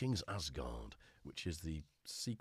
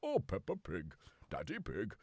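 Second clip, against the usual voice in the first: lightly voiced cartoon dad; a hint of a cartoon father pig; Daddy Pig. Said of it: "doing the daddy voice from Peppa Pig".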